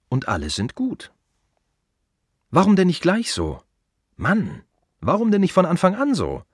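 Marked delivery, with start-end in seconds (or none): 2.5-6.4 higher, louder exasperated why; exasperated outburst. Acted. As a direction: throw up your hands in disbelief, higher and louder. Exasperated outburst about the dead. (entnervter, lauter Ausruf)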